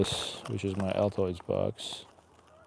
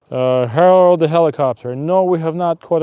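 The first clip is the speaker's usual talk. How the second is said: louder greeting; 7 semitones higher, 11 dB louder than usual. Real, not acted. Greets viewer Harold on stream.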